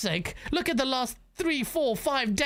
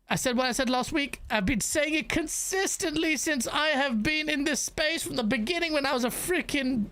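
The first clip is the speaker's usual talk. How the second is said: usual speaking voice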